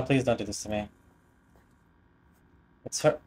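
usual speaking voice

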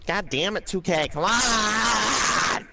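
high, drawn-out mock wail; exasperated outburst. Player complains at the old game's servers, drawn-out exasperated wail.

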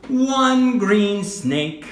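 slow, over-clear teacher voice; slow clear modelling. Shown as a demonstration. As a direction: say it slowly for a child. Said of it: "teacher models phrase slowly".

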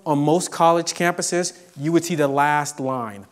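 usual speaking voice

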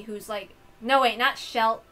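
ordinary talk

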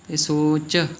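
ordinary talk

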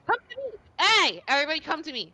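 loud high calls to the group; yelling. Real, not acted. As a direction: yell it out to everyone. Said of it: "Why are you yelling at me?".

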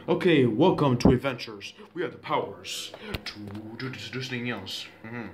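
usual speaking voice

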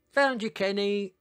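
high happy shout. Acted. Ellie finds Kenny in the reeds, shouting happily. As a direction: call out high and loud, delighted. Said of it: "she shouted".

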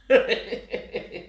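rapid run of chuckles; chuckling laugh. Real, not acted. Ranter laughing mid-rant, rapid chuckle. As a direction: let out a string of short laughs.

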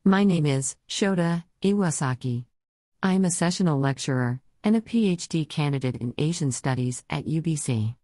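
synthesized text-to-speech voice; computer-generated voice. Real, not acted. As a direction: speak like a computer voice reading text. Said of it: "I use a computer-generated voice, as you can hear now".

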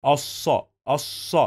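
الص as "as-sa" with a long double s; doubled saad. Shown as a demonstration. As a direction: linger on the saad in الص, then open to a. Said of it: "We pronounce this صاد two times".

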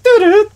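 high loud two-note chime; loud high chime noise; imitated phone chime. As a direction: sing it like a phone alert. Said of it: "it goes".

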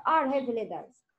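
ordinary talk, nothing marked

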